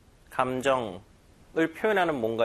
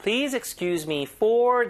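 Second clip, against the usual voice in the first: slow model read; 7 semitones higher, much slower than usual. Shown as a demonstration. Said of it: "이럴 때".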